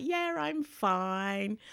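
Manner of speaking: put-on slow, small child's reply; daughter's voice. Daughter reassuring her mum, slow small voice. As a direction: drag it out slowly like a little kid. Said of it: "she's like".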